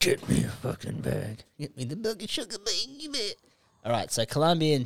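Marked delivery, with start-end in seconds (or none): 0.0-1.4 raspy whispered demand. Pretend. Whispered mock line. 1.6-3.3 high, quick silly voice; high put-on voice.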